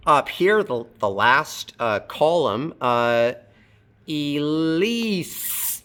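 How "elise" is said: Elise drawn out to a long hissed S; slow demo of Elise ending on S, not Z; final S sound. Shown as a demonstration. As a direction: stretch Elise and hold the final S. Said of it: "Elise ends with an S sound".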